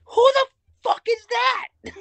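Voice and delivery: very high, loud mock squeal; retold shock in a squeaky falsetto; bewildered outburst. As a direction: screech it in exaggerated disbelief. Acting out their bewildered reply.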